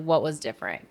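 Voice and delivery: ordinary talk, nothing marked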